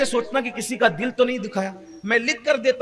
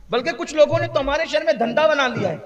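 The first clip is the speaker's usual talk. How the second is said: ordinary talk, nothing marked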